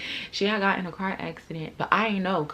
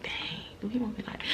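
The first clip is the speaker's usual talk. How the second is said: whispered thought; 4 semitones higher, 8 dB quieter than usual. Retold or imitated. Her wondering what God wants, whispered. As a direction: murmur the thought to yourself.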